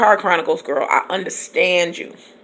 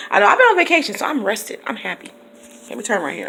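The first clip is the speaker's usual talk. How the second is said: normal talking voice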